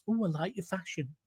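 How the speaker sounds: hint of a fey fashion fan; mock caller. Host mimics caller Michael fussing about fashion, soft and fey. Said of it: "I don't know whether you speak like that".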